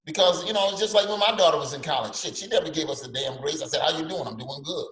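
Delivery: normal talking voice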